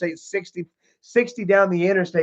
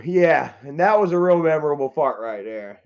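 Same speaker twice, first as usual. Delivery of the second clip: normal talking voice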